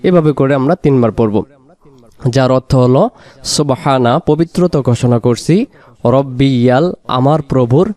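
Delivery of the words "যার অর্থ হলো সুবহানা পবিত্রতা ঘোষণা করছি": normal talking voice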